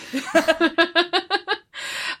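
bright burst of laughter; high laugh. Real, not acted. Laughing at yoga mat joke.

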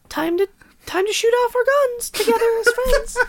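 very high, squeaky happy voice; way up in pitch, gleefully friendly. Acted. Invites friend to shoot off guns, happy. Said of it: "named it as your happy voice".

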